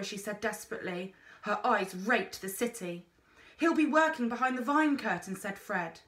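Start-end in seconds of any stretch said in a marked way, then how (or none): 3.6-5.4 quick, breathless reply; fast urgent explanation; hurried answer. A narrator's reading. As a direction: rush the words out. Boy answers in a hurry.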